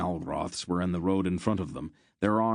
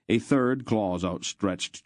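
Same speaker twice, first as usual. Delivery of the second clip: ordinary delivery, nothing marked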